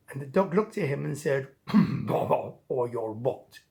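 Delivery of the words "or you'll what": low, slow bully dog voice; taunting dog. Acted. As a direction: drag it out low as a mocking dare.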